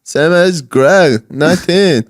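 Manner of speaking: put-on slow, high, odd-accented drawl; Tommy Wiseau impression. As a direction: drag out each word, weird accent. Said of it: "your man from The Disaster Artist".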